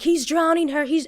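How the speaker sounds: high, imitated alarm; retold freaking out. Bystander spotting someone held underwater, high retold alarm. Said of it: "they start freaking out".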